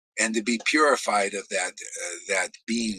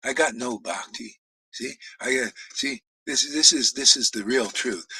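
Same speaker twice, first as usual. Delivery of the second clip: usual speaking voice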